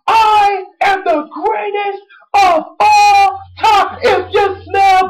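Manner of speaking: mock a wrestler's bragging yell; Daniel Bryan boast. Fan acting out Daniel Bryan's boast, slow high shout. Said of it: "Daniel Bryan's like".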